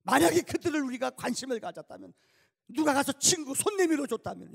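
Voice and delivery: loud, high ringing pulpit cry; impassioned preaching. Acted. Preacher pleading with his congregation, loud and high. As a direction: plead with the church to reach out.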